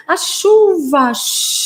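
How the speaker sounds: high, loud, slow "chuva" into long shhh; rain sound effect. Shown as a demonstration. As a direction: stretch "chuva" playfully, then hiss like rainfall. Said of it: "como se tivesse caindo realmente a chuva". (chuva de brincadeira, alongada, com "shhh")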